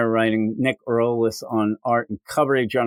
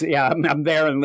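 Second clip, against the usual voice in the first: ordinary talk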